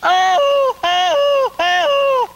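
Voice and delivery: long high whooping holler called far across the river; a ringing country holler, very high and loud; field holler. Shown as a demonstration. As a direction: holler it out like calling to a partner downriver. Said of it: "he'd holler, something like this".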